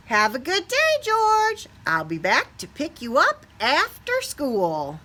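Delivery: high, bright parting call; cheerful goodbye. A narrator's reading. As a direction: wave him off gaily. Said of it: "the man with the yellow hat said".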